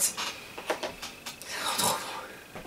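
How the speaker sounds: breathy whisper, slow and drawn out; a slow, delighted whisper over a lovely smell. Real, not acted. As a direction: breathe the words out as you savour the scent. Sniffing the lip balm, delighted.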